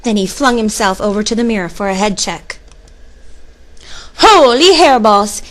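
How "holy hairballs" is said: loud, drawn-out, higher exclamation; stretched-out cry of amazement; amazed exclamation. A narrator's reading. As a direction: exclaim it big and slow. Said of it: "exclaimed Skippy John Jones".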